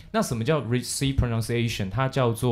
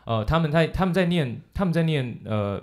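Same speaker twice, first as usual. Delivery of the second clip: usual speaking voice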